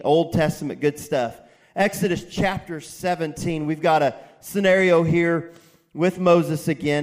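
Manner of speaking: loud, emphatic sermon delivery; full-voiced pulpit preaching. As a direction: preach it out to the congregation. Pastor preaching loudly.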